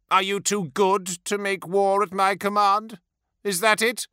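high-pitched, aggrieved questioning; hurt tyrant's bitter complaint; anguished reproach. A narrator's reading. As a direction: accuse him of ingratitude, deeply wounded. Wounded lord reproaches his officer.

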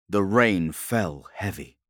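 ordinary delivery

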